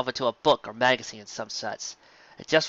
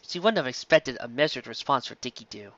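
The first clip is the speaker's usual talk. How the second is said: ordinary delivery, nothing marked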